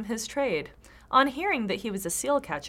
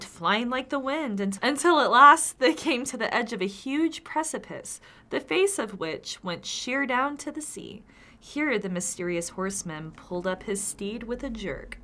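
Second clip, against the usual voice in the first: ordinary talk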